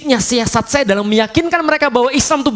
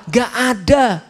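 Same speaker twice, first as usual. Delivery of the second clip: ordinary delivery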